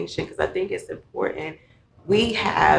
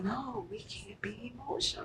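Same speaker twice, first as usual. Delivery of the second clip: breathy whisper, almost no voice; whispered denial; 6 semitones higher, 18 dB quieter than usual. Retold or imitated. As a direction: whisper it like a secret rule. Quoting churchgoers' denial.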